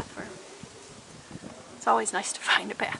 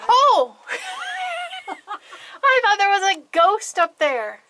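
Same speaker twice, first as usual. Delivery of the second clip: startled shout; 10 semitones higher, 10 dB louder, slower than usual. Real, not acted. Startled by a ghost.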